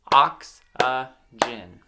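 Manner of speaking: "Oxygen" slow and loud in three separate beats; syllable by syllable. Shown as a demonstration. Teacher giving children a word to blend themselves, syllable by syllable. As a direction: break "Oxygen" apart at each syllable. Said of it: "I'm gonna clap out".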